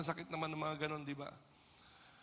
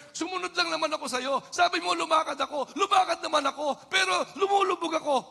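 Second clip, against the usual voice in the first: higher, louder anguished shout; Peter crying out; 13 semitones higher, 11 dB louder than usual. Acted. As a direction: shout it like a man sinking in the waves. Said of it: "Just like Peter". (pasigaw na daing ni Pedro)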